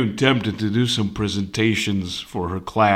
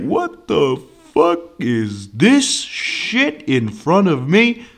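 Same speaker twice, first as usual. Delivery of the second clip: slow, drawn-out imitation of confusion; mildly hammed-up baffled professor; mock bewilderment; 5 semitones higher, slower than usual. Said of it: "she was so fucking confused".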